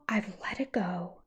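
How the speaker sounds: whispered under the breath. Real, not acted. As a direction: breathe it out as a voiceless aside.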